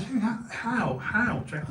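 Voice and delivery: high, incredulous questioning; retold astonishment. Man asks Jack how his bag returned, retold astonishment. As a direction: replay being amazed, pitch lifted.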